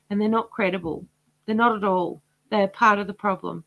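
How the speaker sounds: ordinary delivery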